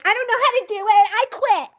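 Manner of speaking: high, fast mock whine of a student giving up; whiny quitter. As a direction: squeal it out like someone ready to quit. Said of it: "you're going".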